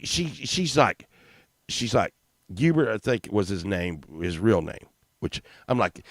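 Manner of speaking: normal talking voice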